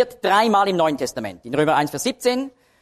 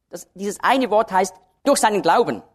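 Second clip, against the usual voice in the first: normal talking voice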